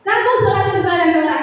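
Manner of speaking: high scolding with glaring eyes. Acted. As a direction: pitch up, a harsh blaming rebuke. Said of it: "Dengan nada suara tinggi".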